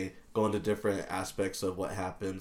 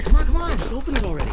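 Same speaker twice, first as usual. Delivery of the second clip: loud, very high impatient pleading; frantic, excited high-pitched yelling; eager urging; 12 dB louder, faster than usual. Acted. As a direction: urge them on, high and loud. Eager cold open before the show.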